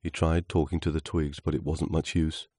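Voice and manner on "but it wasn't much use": plain reading voice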